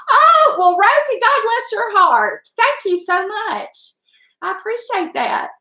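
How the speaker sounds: gushing thanks to a friend; touched and delighted. Real, not acted.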